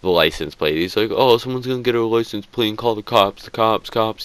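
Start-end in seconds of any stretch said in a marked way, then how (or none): 1.1-4.2 higher, put-on fretful voice; mock scared friend. Friend always bugging about the license plate, mocked. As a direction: mimic his paranoid whine about the cops. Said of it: "He's so scared of cops".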